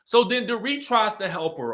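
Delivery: ordinary delivery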